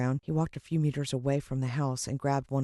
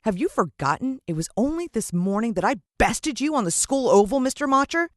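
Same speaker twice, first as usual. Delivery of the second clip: put-on higher, louder cocky boy voice; boyish taunt; 8 semitones higher, 6 dB louder than usual. The boy taunts Mister Motcher, high and cocky. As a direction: jeer like a brave schoolboy.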